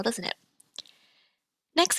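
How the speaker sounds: usual speaking voice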